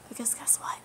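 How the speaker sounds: breathy hushed aside; whisper. Real, not acted. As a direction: drop to a whisper for the reveal. Secret aside in a rant.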